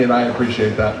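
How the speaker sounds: usual speaking voice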